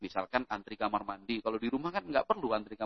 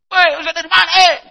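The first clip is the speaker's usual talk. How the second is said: much higher and louder, a booming Eastern Indonesian hail; loud high Ambon voice; 19 dB louder, faster than usual. Retold or imitated. Roommate from Ambon hails the newcomer in a much higher, louder voice. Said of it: "Dia suaranya lebih tinggi".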